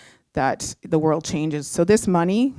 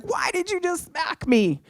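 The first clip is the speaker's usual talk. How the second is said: shocked, much higher squeal; startled yelp; 13 semitones higher than usual. Retold or imitated. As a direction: squeal like you've just been slapped. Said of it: "And she's like".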